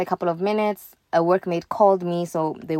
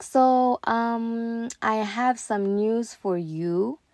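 slow, higher-pitched mimicked speech; slow talking; 5 semitones higher, much slower than usual. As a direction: imitate a drawn-out, slow official. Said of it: "she's talking so slow".

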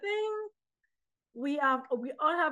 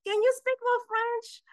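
high-pitched mock audience plea; eager request; 12 semitones higher, 3 dB louder than usual. Audience to the poet after her show, eager request. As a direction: imitate a fan asking, voice way up.

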